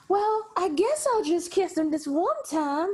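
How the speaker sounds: high, drawn-out Southern belle drawl; theatrical Southern belle, slow and high. Acted. Woman giving in to one kiss, as a Southern belle. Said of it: "make her into, like, Scarlett O'Hara, Blanche Devereaux".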